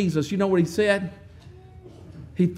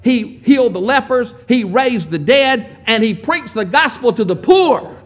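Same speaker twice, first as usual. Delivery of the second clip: building preaching; 3 semitones higher, 6 dB louder than usual. Real, not acted. Preacher stirring the congregation about Jesus' healings, rising fervor. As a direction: build the crowd up with each line.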